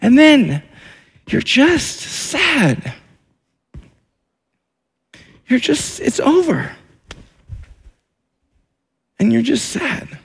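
slow, high, sorrowful lament; a preacher grieving the lost dream. Acted. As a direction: draw it out mournfully. Preaching the grief stage.

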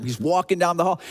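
ordinary delivery

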